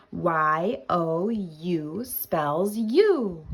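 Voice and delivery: drawn-out Y O U, "you" falling firmly; slow spelling repeat. Shown as a demonstration.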